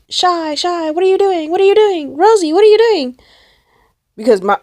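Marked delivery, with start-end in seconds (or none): 0.1-3.2 high-pitched, insistent mock calling; urgent friend. Acting friend's urgent phone call.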